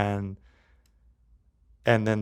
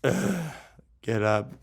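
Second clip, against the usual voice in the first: a hint of weary annoyance; groan, then grudgingly rouse yourself; lightly acted reluctant grumble; grudging Jesus; 4 semitones higher than usual. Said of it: "Or is he like".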